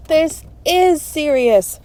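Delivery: slow, urgent insistence; grave insistence. Acted. Friend urges the others to act.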